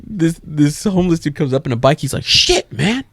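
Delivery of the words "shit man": high, drawn-out aggressive hype; mock-aggressive slow exclamation; hella aggressive. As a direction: imitate a pushy, aggressive compliment. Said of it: "Hella aggressive".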